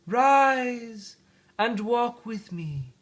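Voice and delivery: high, very slow spirit voice; ghostly spirit voice. Acted. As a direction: draw it out slow like a gentle ghost. The spirit commands gently.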